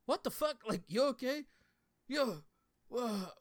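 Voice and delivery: high, loud alarmed outburst; startled. Retold or imitated. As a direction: jump out of your skin at her voice. Startled by wife in the night.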